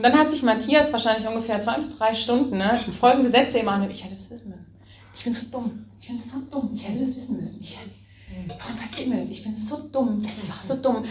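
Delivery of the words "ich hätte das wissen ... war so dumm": quiet self-reproach. Retold or imitated. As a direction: murmur it over and over like a broken record of blame. Retold hours of self-reproach.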